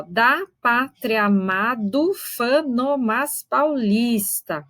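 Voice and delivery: very slow, vowels merged at the joins; slow metrical reading; slow scansion. Shown as a demonstration. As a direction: stretch out the verse to count its syllables. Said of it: "Esse verso não é tão fácil de contar".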